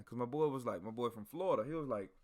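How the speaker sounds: ordinary talk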